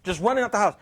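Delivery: usual speaking voice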